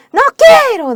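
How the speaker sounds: high, loud shouted no; angry refusal. Retold or imitated. Mison refusing the gospel, high loud shout. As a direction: throw the refusal back at her hard. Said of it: "decía ella".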